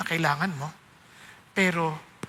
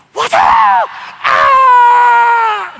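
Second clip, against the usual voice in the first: loud high scream of pain as the lash lands; pained cry; 14 dB louder, much slower than usual. Acted. Father screams as the whip strikes him, loud and high. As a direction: scream out at the blow.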